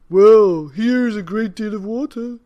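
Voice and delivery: put-on hearty old man pointing out a pond. A narrator's reading. Old man shows the cats a pond, hearty voice. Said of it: "said the very old man".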